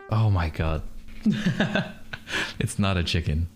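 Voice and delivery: usual speaking voice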